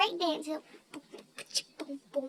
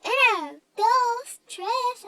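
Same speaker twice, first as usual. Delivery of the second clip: louder, rising higher on each count; loud mock countdown; 5 semitones higher, 7 dB louder, much slower than usual. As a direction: count it off like a playground referee. Friend counts off in Spanish.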